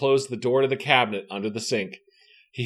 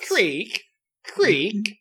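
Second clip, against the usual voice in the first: creaky sound effect; 8 semitones higher than usual. A narrator's reading. Narrator voices creaking stairs.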